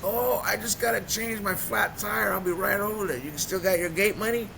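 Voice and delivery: high, eager, over-keen pal; imitated loaded friend. Loaded friend after the gate money, overexcited. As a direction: play a high buddy making excuses. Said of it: "This dude is already loaded".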